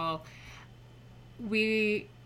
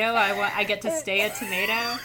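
louder, laughing through the tomato line; real laughter breaking into the words; 8 dB louder than usual. Real, not acted. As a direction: giggle your way through it. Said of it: "Sorry, I'm laughing really hard".

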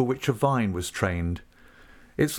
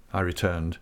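plain reading voice

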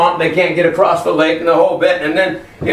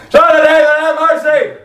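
louder, high pleading shout; yelling for mercy; 9 semitones higher, 3 dB louder than usual. Acted. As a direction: shout past those shushing you. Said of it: "I yelled".

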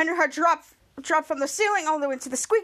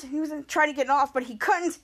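usual speaking voice

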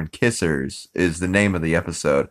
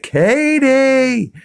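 high, loud yelled name Katie; retold yell; 4 dB louder than usual. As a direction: bellow Katie through the glass at her. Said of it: "and he's like".

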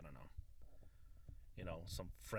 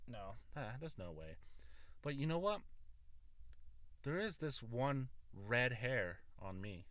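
ordinary talk

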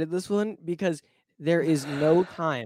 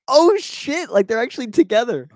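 sudden high, loud "oh shit"; shocked realization; 10 semitones higher, 7 dB louder than usual. Retold or imitated. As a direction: blurt it out in a jolt of surprise. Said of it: "and I'm like".

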